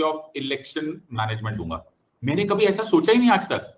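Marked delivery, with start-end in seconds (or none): 2.2-3.7 much higher, louder mock wonder; mock amazement. Teacher pretending to be stunned by a student's idea, high and loud. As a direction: act stunned, playfully.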